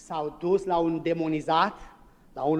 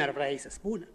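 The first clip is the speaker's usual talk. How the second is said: ordinary talk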